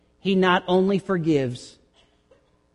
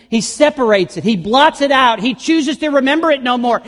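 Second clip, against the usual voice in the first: climbs higher and louder line by line; building preaching; 8 semitones higher, 5 dB louder than usual. Acted. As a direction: build it up toward the shout.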